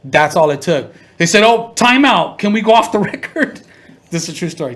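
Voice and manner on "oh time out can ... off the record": higher and louder, flustered; mock panic. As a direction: play the caught-out lawyer, higher and louder. Panicked attorneys quoted.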